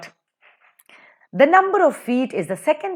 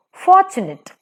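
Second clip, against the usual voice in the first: "fortunate" with FOR high and loud; high, strong "for", lighter "tunate" in "fortunate"; stress on first syllable; 8 semitones higher, 5 dB louder than usual. Shown as a demonstration. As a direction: punch the "for" in "fortunate". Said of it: "For is stressed".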